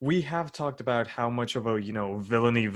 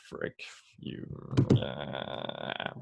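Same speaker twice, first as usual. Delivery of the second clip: unvoiced hushed words; whispering; 9 semitones lower, 6 dB quieter, much slower than usual. Real, not acted. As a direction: whisper so nobody wakes. Whispering at night, household asleep.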